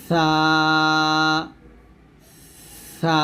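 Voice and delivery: সা with an airy onset and a held vowel; long, steady recitation of সা; one-alif stretch. Shown as a demonstration. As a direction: let the breath out first, then stretch সা evenly. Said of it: "সাটা এক লিফ পরিমাণ টান দিবেন".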